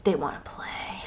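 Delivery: unvoiced tense whisper; whispered warning; hushed whisper. A narrator's reading. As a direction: breathe it out in fear. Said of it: "narrated tense answer".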